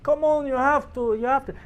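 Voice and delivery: put-on high, pushy Montenegrin accent. Host pushing a shot, high Montenegrin accent.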